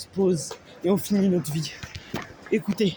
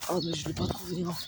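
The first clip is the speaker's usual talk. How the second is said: usual speaking voice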